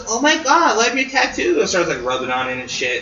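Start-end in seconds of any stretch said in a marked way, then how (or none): 0.1-1.6 put-on high-pitched, gushing girl voice; gushing stripper. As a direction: imitate an excited girl admiring something. Retold stripper gushing over tattoo.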